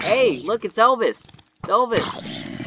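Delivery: high, loud, excited exclamation; excited calling out. Real, not acted. Gamer spotting [name] in the game, excited shout. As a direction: call it out high, like a celebrity sighting. Spots a funny figure in game.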